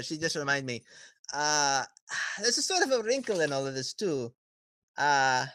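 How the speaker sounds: sighing dealmaker with high swoops; stalling, sing-song villain voice; hesitant villain businessman. Acted. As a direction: break bad news with feigned reluctance. Villain reveals what he knows.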